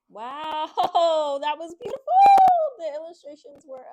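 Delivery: high, loud delighted wow with a laugh; excited. Real, not acted. Reader delighted after finishing the book, excited gush. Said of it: "I'm sorry for my excitement".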